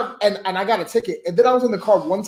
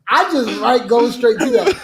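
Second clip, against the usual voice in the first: normal talking voice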